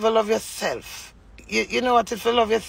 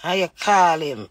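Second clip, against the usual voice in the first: ordinary talk, nothing marked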